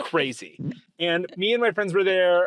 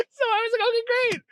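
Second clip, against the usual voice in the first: laughing; much faster than usual. Real, not acted. Laughing through story.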